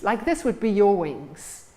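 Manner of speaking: usual speaking voice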